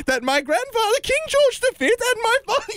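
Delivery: high Queen voice, cracking into laughter. Acted. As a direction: hold the Queen voice while tickled. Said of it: "in a Queen voice?".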